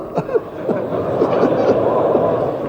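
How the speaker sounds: high unvoiced laugh; breathy laugh. Real, not acted. Preacher laughing at his own brother example, breathy high laugh.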